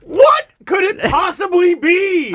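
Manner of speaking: mock ad announcer. Mock ad build-up.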